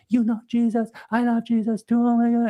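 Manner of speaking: high mock-sobbing gush; mock bawling. Telling how mom cried to the lady, mock bawling. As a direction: imitate a weepy, high-pitched wail. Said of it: "She just starts bawling. She's like".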